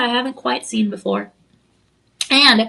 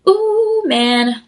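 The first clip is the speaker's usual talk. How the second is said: excited ooh; 7 semitones higher, 6 dB louder than usual. Real, not acted. Gushing over the ring.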